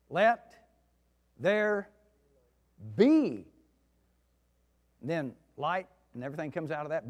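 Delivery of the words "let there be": high and loud, each word held apart; slow thunderous let there be; booming proclamation. Acted. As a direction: proclaim it like God speaking creation. Preacher dramatizes God's creation word.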